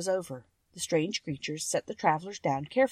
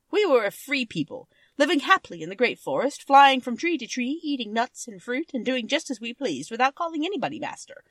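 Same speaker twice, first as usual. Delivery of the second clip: storytelling king, a touch higher than the narration; lightly voiced Monkey King telling his tale; 6 semitones higher, 7 dB louder than usual. Monkey King tells Dorothy his history, lightly voiced. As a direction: spin the old tale, lively in places.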